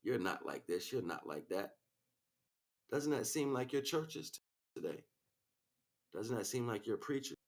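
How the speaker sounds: usual speaking voice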